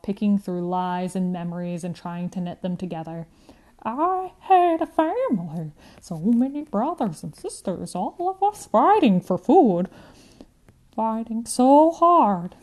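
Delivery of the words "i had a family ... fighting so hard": put-on high, louder old woman with Eastern European accent, slowing at the end; recalling a hungry family, rising intensity; accented grandmother remembering the fight for food; old lady.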